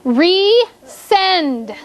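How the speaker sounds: RESEND slowly, split into RE and SEND; syllable-by-syllable dictation. Shown as a demonstration. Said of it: "The next word is".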